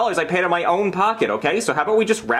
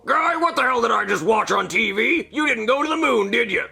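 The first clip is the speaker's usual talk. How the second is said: a hint of a boss yelling on the phone; higher, irritated boss voice; angry boss voice; 6 semitones higher than usual. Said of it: "it's my boss from nasa".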